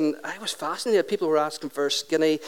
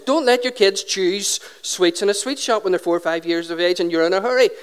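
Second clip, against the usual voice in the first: ordinary talk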